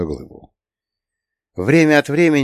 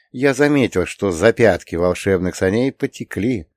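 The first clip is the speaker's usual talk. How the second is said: plain reading voice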